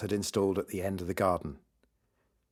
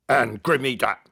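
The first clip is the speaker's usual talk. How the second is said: monster voice; 3 semitones higher, 4 dB louder than usual. Acted. Monster talks through teeth.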